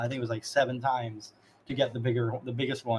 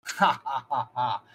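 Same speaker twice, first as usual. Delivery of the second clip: mocking character laugh. Bandit killer laughs in character.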